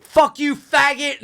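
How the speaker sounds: high, loud yell. Retold or imitated. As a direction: yell it after someone. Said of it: "I would yell and be like".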